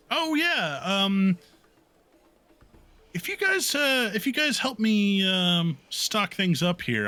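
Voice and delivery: high-pitched little gnome asking a favour; high squeaky gnome. Acted. Busy gnome worker asks students for help, high squeaky voice. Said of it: "she has the high squeaky voice".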